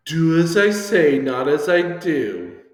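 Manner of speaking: loud, slow, high proclamation; emphatic saying. Acted. Teacher quoting an old saying, loud and emphatic. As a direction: lay down the rule, word by word.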